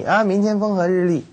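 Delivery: mock the forecast you want to hear; delighted forecast. Picnic-goer imagining the perfect forecast, gleeful. Flatterer repeats sunny forecast.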